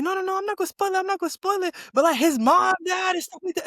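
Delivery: high, squeaky mock of a careless friend; mocking spoiler friend. Mimicking the friend who spoiled the show, high and mocking. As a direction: parody their breezy promise. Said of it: "they was like".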